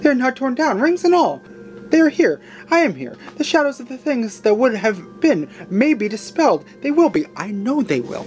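high-pitched overjoyed exclaiming; crying out. Acted. Scrooge embracing his bed curtains, crying out overjoyed. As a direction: cry out with rising joy and relief. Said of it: "cried Scrooge".

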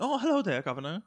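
high-starting mock Cockney greeting; Henry Cavill's Cockney accent. Imitating an actor in an interview, mock Cockney accent. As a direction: play a cheery Cockney, dropping in pitch. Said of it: "Og bare Cockney accent".

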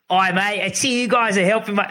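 botched Aussie impersonation; bad Australian accent. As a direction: imitate an Australian mate, not quite managing it. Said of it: "I can't do the accent".

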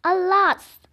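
loud, high cry of alas; loud exclamation. Acted. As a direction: burst out with alarm. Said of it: "Hansel exclaimed".

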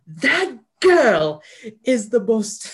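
high, loud exaggerated girl voice; mock Annie voice. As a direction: ham up a bratty little girl. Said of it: "Annie being like".

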